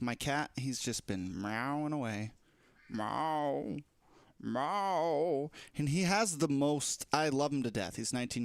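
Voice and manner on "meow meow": mock cat meows. Imitating the cat's meowing.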